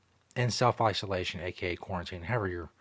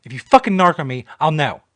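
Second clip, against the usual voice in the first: mock threat; 5 semitones higher, 13 dB louder than usual.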